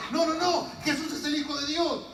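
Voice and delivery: high, insistent denial; urgent correction. Acted. Woman correcting her suspicious sibling, acted urgency. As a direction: rush to set the record straight.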